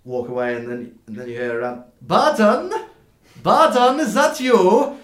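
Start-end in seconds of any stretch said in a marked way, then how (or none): 2.0-5.0 very loud, high-pitched holler; calling out. Acted. Dwarf on the cart hails Burden, high loud calling. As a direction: holler to someone far down the street.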